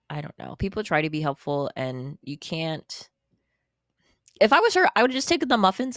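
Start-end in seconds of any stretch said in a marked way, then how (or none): 4.4-6.0 excited. Real, not acted. Reacting to the beggar story.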